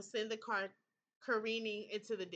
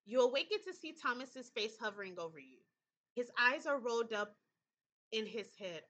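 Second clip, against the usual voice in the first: ordinary talk, nothing marked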